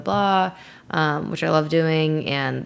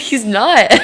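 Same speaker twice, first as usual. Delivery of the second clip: high, loud, laughing through it; giggling protest; laughing denial; 6 semitones higher, 8 dB louder than usual. Retold or imitated. Protesting to her brother about the dog, laughing. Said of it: "I'm like".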